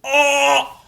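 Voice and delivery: loud high-pitched shocked "oh"; flabbergasted gasp. Acted. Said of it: "He's, like, flabbergasted".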